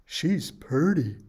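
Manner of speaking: slow, folksy drawl on "purty"; a hint of a rustic dwarf; Sneezy's voice. A narrator's reading. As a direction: admire her bashfully. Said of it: "said the one named Sneezy".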